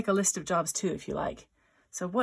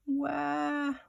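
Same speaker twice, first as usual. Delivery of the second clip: long, high cartoon "waah"; mock crying; 8 semitones higher than usual. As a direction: wail a pretend, drawn-out cry. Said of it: "So crying unicorn".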